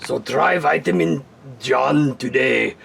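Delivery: slow, smooth TV ad pitch; infomercial voice. Acted. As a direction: sell it like a late-night commercial. Infomercial closing line.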